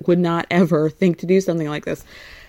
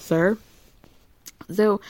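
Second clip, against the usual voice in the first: ordinary talk, nothing marked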